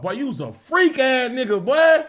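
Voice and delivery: normal talking voice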